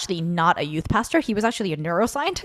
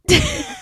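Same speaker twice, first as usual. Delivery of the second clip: high laugh. Real, not acted. Laugh about the pastor singing.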